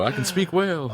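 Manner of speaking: high, eager cartoon-fish voice; playful high impression of a cartoon fish; Dory from Finding Nemo. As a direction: pitch it way up, half laughing. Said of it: "Just picturing Dory from Finding Nemo".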